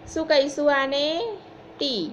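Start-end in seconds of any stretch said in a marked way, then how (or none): none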